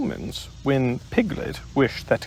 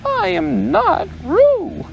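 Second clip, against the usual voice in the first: much higher, louder and slow; loud Piglet; 4 dB louder, slower than usual. Acted. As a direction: insist it loudly, squeaking. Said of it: "said Piglet loudly".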